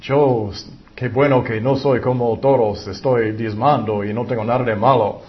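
pompous religious man, pleased with himself; smug Pharisee. Acted. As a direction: play the proud Pharisee praising himself. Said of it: "El fariseo dijo".

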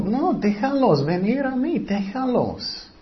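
high, soft insistent reproof, slowing at the end; gentle scolding. Acted. Jesus tells his disciples to let children come, scolding. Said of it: "él regañó a sus discípulos".

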